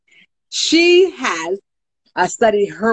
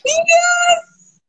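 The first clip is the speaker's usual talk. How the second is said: high, joyful shout of yes; excited cheer; 3 dB louder than usual. Real, not acted. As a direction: cheer it out in triumph. Celebrating her first live.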